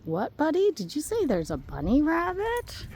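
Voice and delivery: a hint of a puzzled dog asking; speaking dog. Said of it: "I speak dog".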